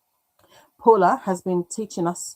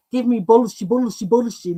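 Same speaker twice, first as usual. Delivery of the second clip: a touch of a character voice; quoting a show; 3 semitones higher, 4 dB louder than usual. Retold or imitated. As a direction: chant it like the show line. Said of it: "That's a reference to a series".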